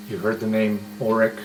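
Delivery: ordinary delivery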